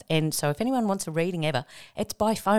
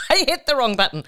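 laughing, fast and a bit higher; 7 semitones higher, 6 dB louder, faster than usual. Real, not acted. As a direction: giggle through the slip-up.

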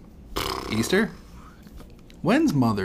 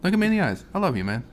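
mock earnest; 8 semitones higher than usual. Mock sincere plea to cohost.

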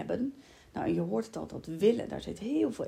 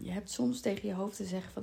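normal talking voice

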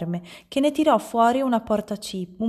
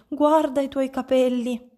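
higher-pitched, insistent alarm; shocked shriek; 6 semitones higher, 3 dB louder than usual. A narrator's reading. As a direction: urge them to look, appalled. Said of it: "strillò lei". (strillo allarmato)

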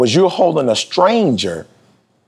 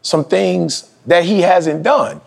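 high, slow insistence on seniority; retold boast, pitched high; defensive pride; 7 semitones higher than usual. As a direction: claim your experience proudly. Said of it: "I'm like".

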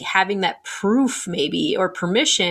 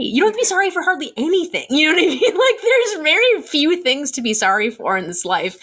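fast, higher, laughing through the words; 7 semitones higher than usual. Real, not acted. Podcast guest laughing as she tells women not to apologise. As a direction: laugh it off while reassuring.

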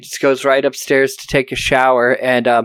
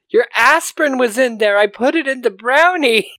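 dotty wife; 10 semitones higher than usual. Retold or imitated. Wife explains the brownie.

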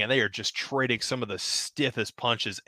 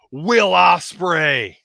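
higher, louder, slow reveal of the name; dramatic reveal; 7 semitones higher, 7 dB louder, much slower than usual. Acted. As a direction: announce it like an arena reveal. Dramatic reveal of hooded figure.

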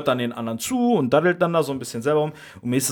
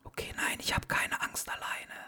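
hushed whisper; 2 semitones higher, 13 dB quieter than usual. Acted. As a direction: keep it whispered. Joins whispered back-and-forth.

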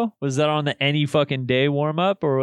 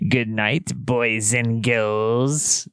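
slow, low ghoulish host; Crypt Keeper voice; 6 semitones lower, much slower than usual. Acted. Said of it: "your line as the Crypt Keeper".

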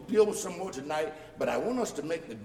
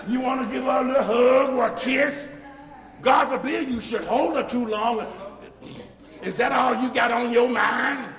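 high, loud, laughing mock scold; mocking the legalists; 8 semitones higher, 7 dB louder than usual. Preacher mimicking legalists who scold couples, mocking laughing scold. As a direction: lampoon nosy church folk scolding a couple.

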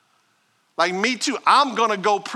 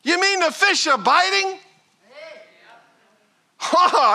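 normal talking voice

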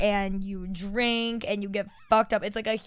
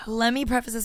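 ordinary talk

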